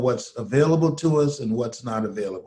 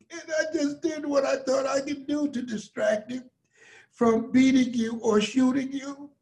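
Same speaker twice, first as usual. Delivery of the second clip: mother's tearful apology; 10 semitones higher than usual. Retold or imitated. Mother apologising to her son after beating him, tearful. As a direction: apologise through tears as his mother. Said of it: "my mother started crying".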